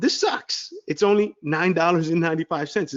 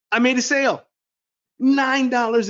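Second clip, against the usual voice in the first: high, louder burst of joy; ecstatic; 8 semitones higher, 4 dB louder than usual. Real, not acted. Telling viewers about his first sale, thrilled. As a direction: announce the first sale like a win. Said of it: "And I am ecstatic".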